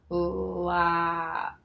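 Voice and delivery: 'Lap' stretched out sound by sound; slow blend. Shown as a demonstration. Teacher blending the sounds together, slow 'Lap'. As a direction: draw 'Lap' out very slowly. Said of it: "Together slowly".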